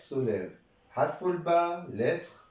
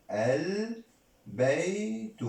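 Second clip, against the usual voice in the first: very slow al-bay-tu, higher pitched; syllable by syllable; 5 semitones higher, much slower than usual. Shown as a demonstration. Teacher sounding out البيت for learners, slowly in pieces. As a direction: separate each part of البيت.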